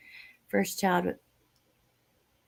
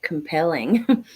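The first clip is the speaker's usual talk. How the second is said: slow sarcasm; 2 semitones lower, 4 dB louder, slower than usual. Real, not acted. Mocking a weak claim.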